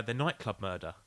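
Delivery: ordinary talk, nothing marked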